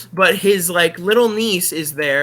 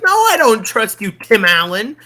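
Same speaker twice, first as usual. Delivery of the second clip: put-on higher, louder indignant girl; higher, louder mock little girl refusing; imagined niece; 7 semitones higher, 3 dB louder than usual. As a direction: talk back like a scared kid, higher. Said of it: "I would be like".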